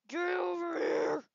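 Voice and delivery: slow booming Scorpion battle cry; Scorpion impression. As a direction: roar it like Scorpion's spear call. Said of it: "How'd you like my scorpion impression?".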